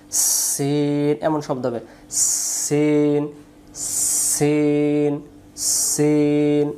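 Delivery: সিন with a very long drawn hissing s sound from the teeth. Shown as a demonstration. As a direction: stretch the s of সিন into a long hiss before the vowel. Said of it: "শিশি দিয়ে পড়তে হয়".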